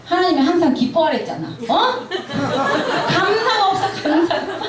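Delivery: mock scolding through laughter; nagging mother. Mother nagging her daughter about gratitude, laughing. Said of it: "제 딸한테 제가 그런 말 많이 하거든요".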